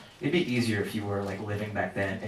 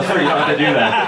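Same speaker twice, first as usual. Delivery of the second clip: laughing; 4 semitones higher, 10 dB louder than usual. Retold or imitated. Agent voiced, laughing.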